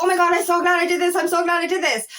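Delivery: hammed-up joy at having done it; mock relief. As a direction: gush with relieved delight. Imitating relieved excitement.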